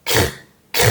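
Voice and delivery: "k" released into raspy throat French R; CR cluster. Shown as a demonstration. Teacher sounds out C plus R, "k" with throaty rasp. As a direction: say "k" as krr, without a vowel. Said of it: "la C y la R al principio pues suena".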